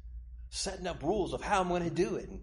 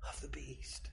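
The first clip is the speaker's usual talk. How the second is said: whisper; 16 dB quieter than usual. Acted. Dramatic aside in sermon.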